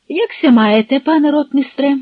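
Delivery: put-on heartiness; forced cheer. A narrator's reading. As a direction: greet him with a forced smile. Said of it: "— силовано всміхнувся Шемберг".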